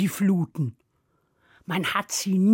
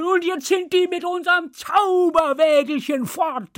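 high, complaining ogre outburst; indignant cry; 7 semitones higher than usual. Acted. Man-eater sees magic cart escape, crying out. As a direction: call out in exasperation, pitch jumping up. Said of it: "rief der Menschenfresser".

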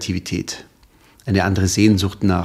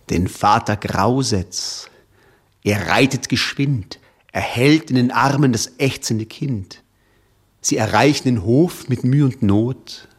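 dramatic poem recital. Shown as a demonstration. Guest reciting his favourite poem on air, dramatic. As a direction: recite the ballad with grave suspense. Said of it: "Jetzt soll ich was aufsagen?".

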